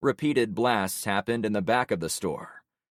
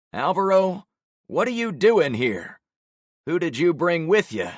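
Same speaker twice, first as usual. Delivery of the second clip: Javon's voice; 9 semitones higher, 7 dB louder than usual. Acted. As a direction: catch him out in the lie.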